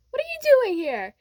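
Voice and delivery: high-pitched astonished question; acted surprise. Deku finding Kacchan at his party, surprised. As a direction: ask it way up high, taken aback.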